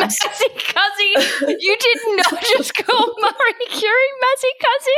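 high, laughing squeal of disbelief; cracking up in shock. Real, not acted. Cohost squeals through laughter at the silly nickname.

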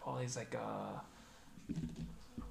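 ordinary delivery

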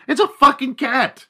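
high, loud exasperated outburst; exasperated yell. Real, not acted. Comic venting at overprotective cat owners, high and loud. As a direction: blow up in disbelief.

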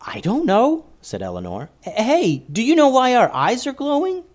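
a hint of a nervous girl asking for help; young girl voice. A narrator's reading. Eleanor pleading to the forest people, lightly childlike. Eleanor anxious, asks Selena.

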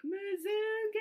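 high, loud mock call of kids; kids yelling Muzungu. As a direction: call it out high like kids shouting at a passing bike. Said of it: "yell at you".

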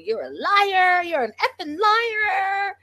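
mimicking an angry husband's rant, hammed-up high whine; mocking Pedro rant. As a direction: wail it like a mock tantrum. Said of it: "just started cussing her out".

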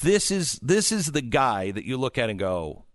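ordinary talk

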